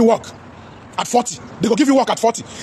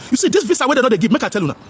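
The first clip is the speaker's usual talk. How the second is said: usual speaking voice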